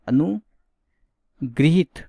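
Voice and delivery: अनुगृहित said very slowly, syllable by syllable, stressing गृ; correct slow pronunciation. Shown as a demonstration. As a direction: stretch out अनुगृहित carefully, each syllable clear. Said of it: "क्या होता है?". (अनुगृहित को बहुत धीरे, हर अक्षर साफ़ बोलिए)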